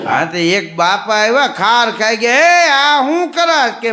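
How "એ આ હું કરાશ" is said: loud, high, slow scolding cry; elder scolding the man feeding bulls; angry old man. Acted. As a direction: shout at him in outrage. Angry old man confronts feeder.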